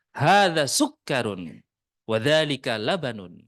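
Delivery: light Arabic accent. Shown as a demonstration. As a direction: say it with a touch of Arabic accent, unhurried.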